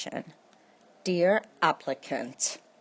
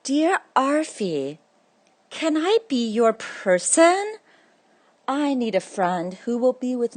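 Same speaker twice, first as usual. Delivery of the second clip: eager letter; 6 semitones higher than usual. Acted. Letter carrier writes to Arfie.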